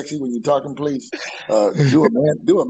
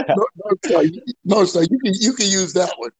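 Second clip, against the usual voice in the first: higher, laughing through it; laughing apology; 4 semitones higher, 3 dB louder than usual. Real, not acted. Telling a friend he can use the joke, laughing. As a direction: apologise while still laughing.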